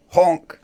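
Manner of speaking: a shrill startled goose honk. Acted. Goose noise.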